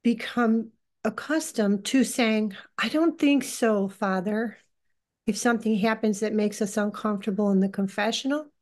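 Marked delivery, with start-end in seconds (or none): none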